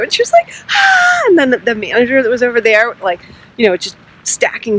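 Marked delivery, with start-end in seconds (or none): 0.7-1.2 very high imitated gasp of surprise; imitated delighted gasp. Register worker receiving flowers, imitated delighted gasp. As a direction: mimic her high, drawn-in "ah".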